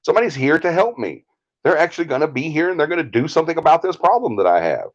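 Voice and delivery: high-pitched retold relief; imitated sudden happiness. Client hearing somebody believes her, imitated sudden happiness. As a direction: play her delight, pitched well up. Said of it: "she's all of a sudden happy".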